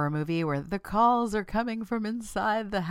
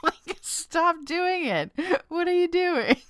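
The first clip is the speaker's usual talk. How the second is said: high, loud mock exasperation; comic telling-off, close to laughing; playful scolding; 11 semitones higher, 5 dB louder than usual. Host scolding listeners for self-talk, in mock outrage.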